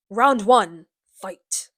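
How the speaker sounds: loud opening, dropping off on the last word; mock game announcer. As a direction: announce it like a video game bout. Mimics fighting game announcer.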